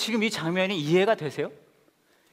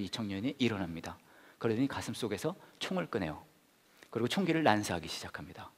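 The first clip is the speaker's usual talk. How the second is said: whispered, no voice; 속삭이듯; hushed whisper; 7 semitones lower, 8 dB quieter than usual. Acted. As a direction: drop to a whisper for the horror. Tells of the shooting.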